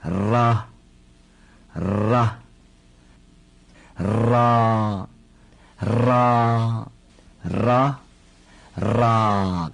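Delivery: ra very slowly, with a long trilled r; ra drawn out with a long rolled r. Shown as a demonstration. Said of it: "重复念".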